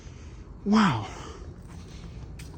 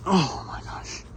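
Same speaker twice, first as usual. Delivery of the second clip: breathless whisper; 3 semitones higher, 5 dB quieter, slower than usual. Real, not acted. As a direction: whisper it in awe. Creepy campsite.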